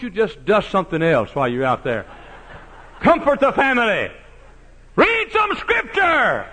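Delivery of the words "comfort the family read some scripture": slow, high drawn-out cry; preacher's sustained shout; drawn-out preaching. Acted. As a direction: stretch every word from the pulpit.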